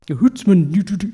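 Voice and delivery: lightly hammed-up fake Scottish accent; mock the stage Scots voice, lightly. Mocking film clichés, mild fake Scottish accent. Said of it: "no one speaks like that".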